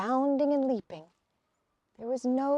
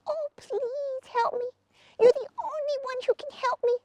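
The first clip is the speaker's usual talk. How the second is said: much higher crying plea; crying lizard; 12 semitones higher than usual. A narrator's reading. Lizard begs Hazel for help, much higher and crying. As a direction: beg tearfully like a tiny lizard.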